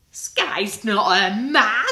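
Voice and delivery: loud mocking jeer with a laugh. A narrator's reading. Tom jeering at his sister, loud and laughing. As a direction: taunt your sister, laughing. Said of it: "jeered Tom".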